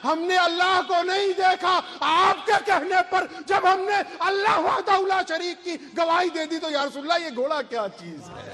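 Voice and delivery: high, impassioned preaching voice; fervent avowal. Acted. Companion explains his faith to the Prophet, fervent and high-pitched. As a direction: build in fervour, pitch soaring, then come down at the end.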